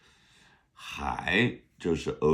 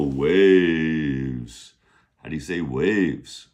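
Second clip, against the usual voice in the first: ordinary talk, nothing marked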